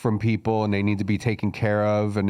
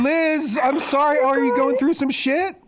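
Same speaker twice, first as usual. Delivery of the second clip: high sympathetic coo. Real, not acted. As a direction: coo over her like a worried friend. Consoling crying friend on phone.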